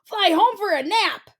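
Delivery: high squeal of a burnt bird; a scorched eagle squealing as he flees. A narrator's reading. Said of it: "he squealed".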